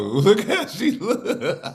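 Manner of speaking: cracking up, words stretched through laughter; helpless laughter. Real, not acted. Cracking up at the clip.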